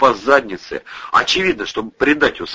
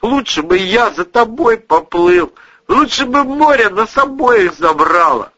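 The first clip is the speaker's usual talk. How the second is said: anguished crying; 12 semitones higher, 5 dB louder than usual. A narrator's reading. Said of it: "всхлипнул сквозь дрему забинтованный".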